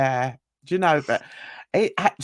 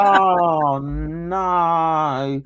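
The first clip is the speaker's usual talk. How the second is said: dismayed groan; 4 semitones lower than usual. Retold or imitated. Retold dismay at the guest.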